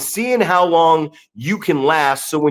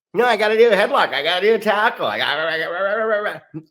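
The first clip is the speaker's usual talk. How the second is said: mocking whiny trainee; 5 semitones higher, faster than usual. Mocking impatient wrestlers.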